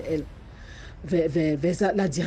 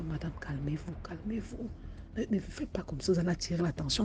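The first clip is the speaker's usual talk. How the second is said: hushed calming; 10 dB quieter than usual. Acted. Guard hushes frightened woman.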